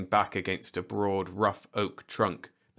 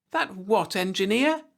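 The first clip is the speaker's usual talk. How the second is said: higher, louder, sharp question; spirited demand; 13 semitones higher, 5 dB louder than usual. A narrator's reading. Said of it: "she demanded spiritedly".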